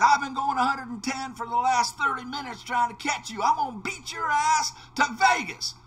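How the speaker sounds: cowboy daring the driver to race him to Vegas; cocky challenge. Retold or imitated. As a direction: throw down the race dare loud and high. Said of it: "he says".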